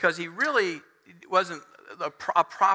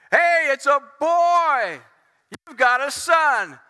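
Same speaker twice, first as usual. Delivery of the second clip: loud, high, drawn-out birth news; hollered proclamation; joyful announcement; 7 semitones higher, 7 dB louder, slower than usual. Acted. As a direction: call out the glad news to a new father. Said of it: "who brought the news to my father".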